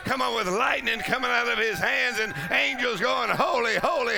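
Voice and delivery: high-pitched revival fervour; full-force preaching. Real, not acted. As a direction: build it fervently for the congregation.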